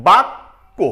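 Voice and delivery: বাক্য loud, doubled k, rising; loud teaching example; pronounce bakko. Shown as a demonstration. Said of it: "যেমন".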